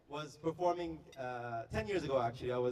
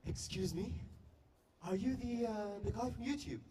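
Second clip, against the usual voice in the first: put-on higher-pitched girl's voice, tentative question; lightly acted British girl approaching someone; 5 semitones higher than usual. British girl stopping him in the lobby, British girl voice. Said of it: "this British girl stops me and says".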